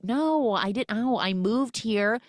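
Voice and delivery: high, mocking sarcasm; sarcastically brushing off his apology; wry joking to her husband; sarcastic retort. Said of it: "And she's like".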